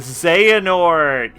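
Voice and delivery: name dragged out slowly and loud; drawn-out exclamation. Real, not acted. Streamer realises the villain's plan, drawing the name out loud. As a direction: call the villain's name out long.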